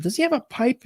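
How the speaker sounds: high curious question; mother asking about the pipe; mother's voice. Retold or imitated. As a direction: ask eagerly. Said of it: "my mother said".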